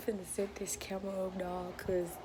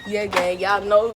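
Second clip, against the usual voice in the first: shouting; 17 dB louder than usual. Real, not acted. Loud after laughing fit.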